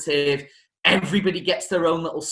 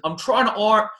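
lightly put-on higher Baltimore accent as Edna; a hint of Edna's Baltimore accent, raised pitch; 7 semitones higher than usual. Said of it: "thinking with his accent".

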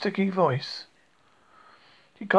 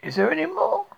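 a high, quick, hopeful question; quick eager question; 11 semitones higher than usual. A narrator's reading. Pooh quickly asking Rabbit for more, high. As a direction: snap up the hint of more food. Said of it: "said Pooh quickly".

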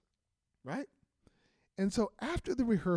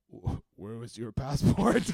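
mock Soviet official; 7 semitones lower, 9 dB louder than usual. Imitating Soviet officials questioning Rob.

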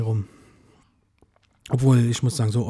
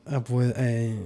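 usual speaking voice

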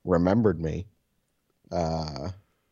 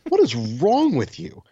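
exasperated outburst; 12 semitones higher, 6 dB louder, slower than usual. Retold or imitated. Friend sent his ID to everyone.

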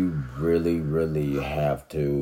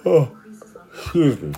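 yawning; 11 semitones higher, 6 dB louder than usual. Real, not acted. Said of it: "I yawned".

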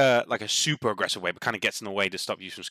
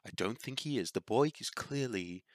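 soothing dryad voice; 8 dB quieter than usual. Acted. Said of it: "the other one goes".

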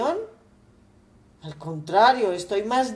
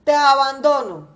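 a raised, indignant father's voice, a touch higher; angry father; 4 semitones higher, 7 dB louder than usual. Acted. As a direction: scold the son and forbid his return. Padre furioso repudia al hijo. (padre indignado, voz algo más alta)

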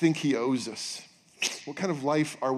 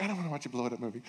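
quick, soft imitation of his wife; wife's voice; 8 dB quieter, much faster than usual. Husband imitating his wife refusing the movie, fast and soft. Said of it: "That's how she talks".

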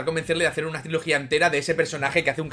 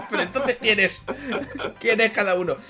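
laughing; 6 semitones higher than usual. Real, not acted. Laughing at the joke.

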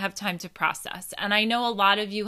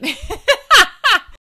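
loud high burst of laughter; real high-pitched cackling laugh; 12 dB louder than usual. Real, not acted. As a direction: laugh out loud at your own slip. Laughs at own slip of tongue.

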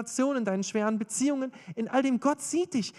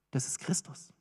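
very soft, low-pitched answer; hushed reveal; 7 semitones lower, 10 dB quieter than usual. Real, not acted. Preacher reveals who the angel is, hushed. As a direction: drop right down for the revelation.

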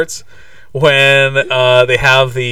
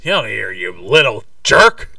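hammed-up jerk uncle, pitched up; mock scolding in a put-on uncle voice; 5 semitones higher, 4 dB louder than usual. Parodying the uncle telling off Kevin, hammed-up jerk. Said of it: "he was a bit of a jerk".

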